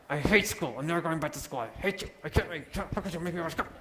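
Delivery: lightly voiced angry child, a touch whiny. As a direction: play a mildly upset kid who hates school. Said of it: "a child that might come home and say".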